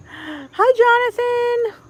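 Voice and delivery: high, loud, drawn-out hello; excited greeting. Real, not acted. Greeting Jonathan on the livestream, excited. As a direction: call the name out long and high.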